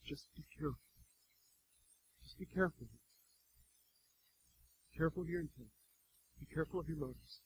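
very soft caution; gentle warning. Retold or imitated. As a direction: warn gently in a hush.

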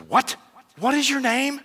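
can't believe what he heard; king stunned that the soldier shares his name; incredulous king. Acted. King startled by same name.

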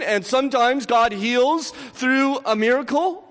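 full-force preaching. Acted. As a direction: preach it out to the whole room. Preacher affirming healing.

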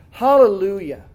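a loud, ringing Hallelujah; proclaimed hallelujah. Acted. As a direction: call it out like a crowd in heaven. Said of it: "crying out".

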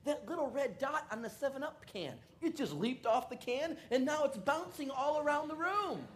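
high, slowed wonder; high friend amazed by the seven up dot; stoned friend. Retold or imitated. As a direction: describe it like it's magic.

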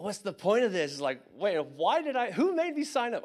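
exasperated questioning. Retold or imitated. Recruit questioning himself in boot camp, exasperated. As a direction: protest as if asking who got you into this.

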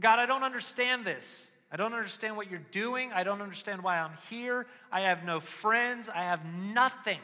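high, loud anguished plea to God; young man pleading with God in despair; desperate prayer. Retold or imitated. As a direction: cry it up to heaven.

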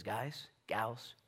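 hushed, breathy whisper to the crowd; whispered call. Retold or imitated. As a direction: lean in and whisper it. John the Baptist addressing crowd.

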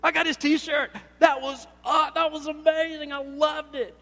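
high, loud, laughing rave; mock superfan raving after the concert; gushing convert. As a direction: gush like a new superfan. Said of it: "I went".

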